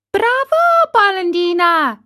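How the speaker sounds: very high, loud little squeal; tiny voice crying out. A narrator's reading. Unseen tiny voice taunting Geppetto, crying out. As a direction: pipe it out in a tiny high cry. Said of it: "cried the same tiny voice".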